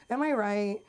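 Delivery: ordinary talk, nothing marked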